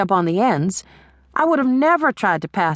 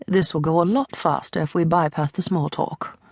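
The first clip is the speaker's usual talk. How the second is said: put-on softer, lower, icy British accent; cold British accent; 3 semitones lower, 3 dB quieter than usual. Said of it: "she says in a cold British accent".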